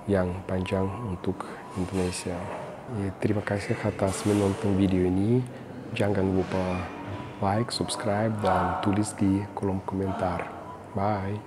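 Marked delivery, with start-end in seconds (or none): none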